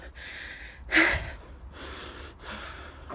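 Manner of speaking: long breathy high sigh; heavy exhausted sigh. Real, not acted. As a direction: sigh out from the hill climb. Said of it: "this is how I'm feeling".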